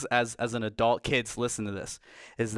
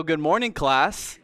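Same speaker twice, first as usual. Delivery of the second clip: teacher voice; 4 semitones higher, 4 dB louder, slower than usual. Shown as a demonstration. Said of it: "That is my teacher voice".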